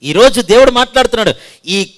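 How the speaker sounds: loud, high impassioned preaching; preaching at full force. Acted. Pastor urging the congregation to return, fervent preaching. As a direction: build to a fervent call to repentance.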